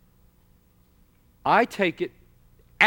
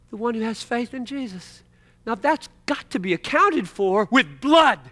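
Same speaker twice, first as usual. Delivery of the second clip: ordinary talk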